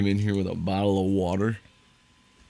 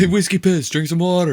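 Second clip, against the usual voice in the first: mock call-out; 8 semitones higher, 3 dB louder than usual. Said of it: "That's just straight up like".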